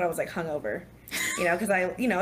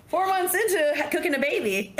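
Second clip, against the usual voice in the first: higher, amused, laughing at her own story; 7 semitones higher than usual. Real, not acted. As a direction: deliver it with a grin, half laughing. Laughing at herself.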